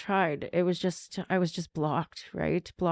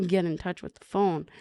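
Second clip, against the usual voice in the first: ordinary talk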